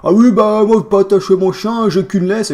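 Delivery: loud, high imitation of a rustic oaf; country yokel voice. Dog owner making excuses about the leash, yokel voice. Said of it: "avec sa voix de beauf".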